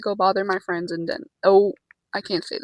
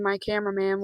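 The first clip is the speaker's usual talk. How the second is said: ordinary talk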